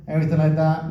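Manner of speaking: ordinary delivery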